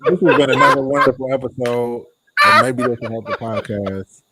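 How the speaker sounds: laughing mock show sign-off. Real, not acted. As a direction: sign off while still laughing.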